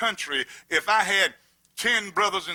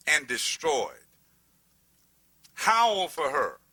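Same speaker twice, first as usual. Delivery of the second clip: scripture reading; 2 semitones lower than usual. Acted. Reads scripture aloud.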